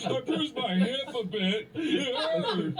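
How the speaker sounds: high-pitched mock-pained whine; mock whining. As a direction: whine about it like a hurt child, hammed up. Mock complaint about a bruised hip.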